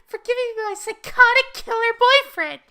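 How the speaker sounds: put-on very high, loud squeaky girl voice; Misa. Misa thanks Rem for her killer boyfriend, hammed-up gushing. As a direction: gush it in a piercing high pitch. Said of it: "Misa's like".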